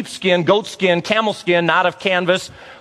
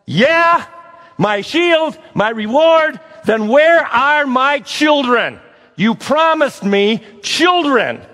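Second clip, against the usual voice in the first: bold demand; 6 semitones higher, slower than usual. Acted. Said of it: "he says it respectfully, but he stands right up".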